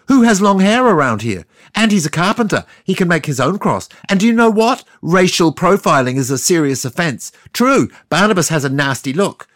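high, loud yell from the crowd. Retold or imitated. Narrator in the crowd yelling to Pilate, loud yell. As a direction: shout it out over the crowd. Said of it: "so I said".

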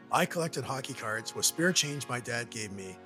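ordinary talk

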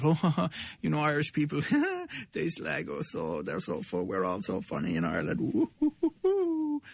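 hammed-up chuckling mimicry with high hoots; put-on joviality, ending in high woo-hoos; mock jolly deflection. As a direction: imitate someone laughing it all off. Mimics Jamie laughing off criticism.

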